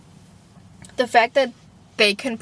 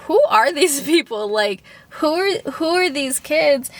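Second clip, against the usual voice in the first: higher, laughing through who are these people; half-laughing, baffled; laughing disbelief; 5 semitones higher than usual. Retold or imitated. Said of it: "And I was like".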